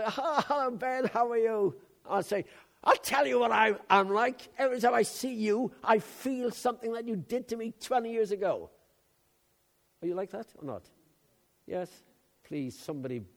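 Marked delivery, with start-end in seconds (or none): 0.1-1.8 mock sweet greeting. Mock greeting to an old enemy. 2.8-8.7 pretend angry retort. Imagined angry retort to an old enemy.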